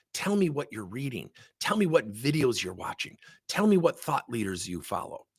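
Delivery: ordinary talk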